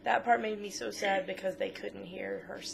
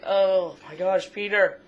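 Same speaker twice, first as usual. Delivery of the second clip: excited gasp; 12 dB louder, slower than usual. Retold or imitated. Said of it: "and it's like".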